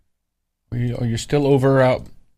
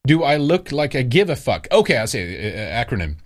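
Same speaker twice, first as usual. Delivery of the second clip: normal talking voice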